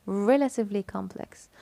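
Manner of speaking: "Relatively" leaned on at its first syllable; stress on RE. Shown as a demonstration. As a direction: make "Re" stand out in "Relatively". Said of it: "So the stressed syllable is". (التشديد على "Re" في "Relatively")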